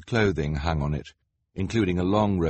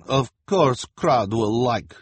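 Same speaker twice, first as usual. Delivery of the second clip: put-on high, slow, loud accented boss; smug fortune-teller; Madame Lulu voice; 4 semitones higher, 5 dB louder, slower than usual. A narrator's reading. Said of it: "Lulu said".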